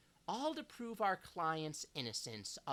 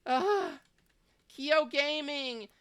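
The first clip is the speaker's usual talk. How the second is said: excited greeting; 10 semitones higher, 8 dB louder, slower than usual. Real, not acted. Greeting the raiders.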